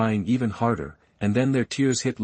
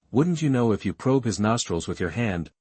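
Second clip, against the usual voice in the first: ordinary delivery, nothing marked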